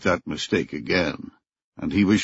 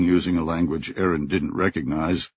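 plain reading voice

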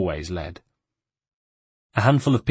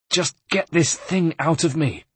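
higher, pained snarl; 8 semitones higher than usual. A narrator's reading. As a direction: snarl it through the pain. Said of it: "snarled Rosa".

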